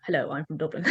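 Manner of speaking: understated Dublin-accent mimicry; Brendan Gleeson in Dublin accent. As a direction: say it in unaltered Dublin Irish. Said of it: "He'd be acting in an American film".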